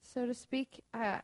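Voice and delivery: ordinary delivery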